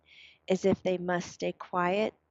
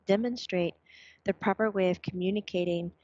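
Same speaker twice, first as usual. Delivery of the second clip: ordinary talk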